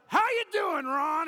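high, loud, overbearing hello; loud pushy greeting with a crushing handshake; mock forceful greeting. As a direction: greet him loudly while squeezing hard. Squeezing arthritic hand, forcing salvation.

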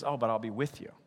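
quiet, gentle voice of God; God soothing a scared disciple; soft reply as God. Retold or imitated. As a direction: hush it down, reassuring. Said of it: "He goes".

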